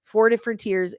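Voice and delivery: ordinary talk, nothing marked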